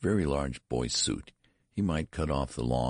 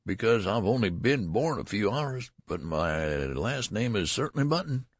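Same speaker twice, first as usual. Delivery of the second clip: querulous whine; 6 semitones higher than usual. A narrator's reading. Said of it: "replied the querulous whine".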